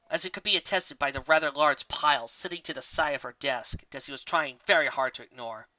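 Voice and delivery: ordinary delivery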